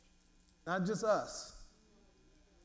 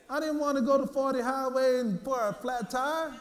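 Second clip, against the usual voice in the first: rising preaching fervor; 6 semitones higher, 5 dB louder than usual. Acted. Preaching builds to full force.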